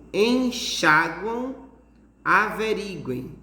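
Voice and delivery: "enxaguam" slowly, leaning hard on xa, then "averiguem" on ri; slow teacher's demo, strong xa in "enxaguam", strong ri in "averiguem"; strong syllable stress. Shown as a demonstration. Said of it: "essa tonificação bem forte no chá".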